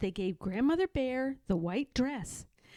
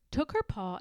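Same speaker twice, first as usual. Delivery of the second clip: usual speaking voice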